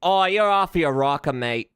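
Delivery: loud-starting mock British, veering Australian; British accent turned Australian. Streamer practising British slang, in an accent that keeps turning Australian. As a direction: try for cheeky British, come out Australian. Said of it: "I keep doing Australian".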